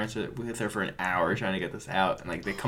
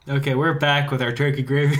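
mock host intro; 2 semitones higher, 5 dB louder than usual. Mock podcast opening.

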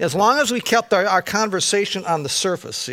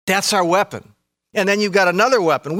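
usual speaking voice

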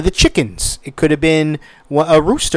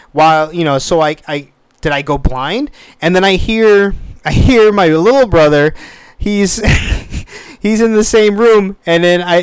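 ordinary delivery

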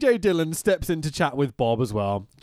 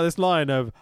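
ordinary talk

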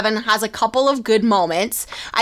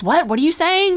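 higher startled question; a puzzled Bob, raised; imitating Bob; 8 semitones higher than usual. Bob asking what the kid is saying, higher and startled. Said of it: "Bob really was".

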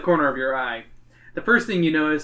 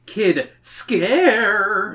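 put-on "Kid, scare" split apart, SCARE drawn out and higher; higher, stretched-out "scare" after a separate "Kid"; ominous mishearing; 5 semitones higher, 3 dB louder than usual. A narrator's reading. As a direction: split it into "Kid", then a long spooky "scare". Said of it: "Was it your imagination? Or did Zoe say".

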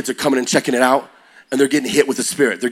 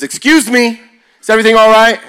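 much higher, louder prim teacher voice; shrill teacher; 11 semitones higher, 8 dB louder than usual. Retold or imitated. Teacher interrupting a laughing student, high and loud. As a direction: play the startled teacher.

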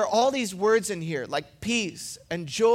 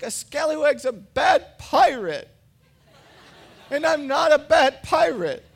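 whining son; 6 semitones higher, 6 dB louder than usual. Retold or imitated. Six-year-old son protesting to dad, loud and whiny. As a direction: insist you're not a bad pirate, upset. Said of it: "starts whining and crying".

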